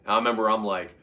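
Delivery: ordinary delivery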